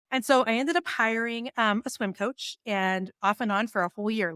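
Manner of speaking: ordinary delivery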